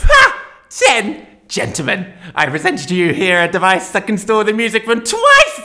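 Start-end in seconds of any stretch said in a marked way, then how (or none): 0.0-0.5 sharp scornful laugh, much higher and louder; scoffing ha. Acted. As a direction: bark a mocking laugh.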